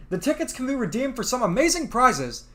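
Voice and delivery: ordinary delivery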